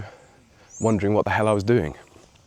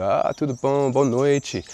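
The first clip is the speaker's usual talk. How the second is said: lightly voiced host greeting guests, pitched up; captain's greeting; 6 semitones higher, slower than usual. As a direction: imitate a captain welcoming passengers in Portuguese. Acts captain greeting guests in Portuguese.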